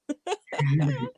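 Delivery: light giggle winding down; laugh. Real, not acted. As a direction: let the laugh fade out. Laughter trailing off.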